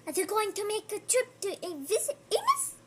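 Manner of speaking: gasping with high excitement; excited gasps. Real, not acted. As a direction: gasp and rush with delight.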